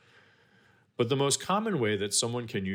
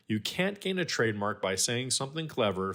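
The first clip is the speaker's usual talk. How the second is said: ordinary talk, nothing marked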